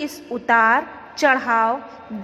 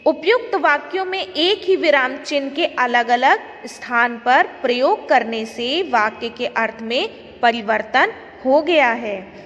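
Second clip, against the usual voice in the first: ordinary talk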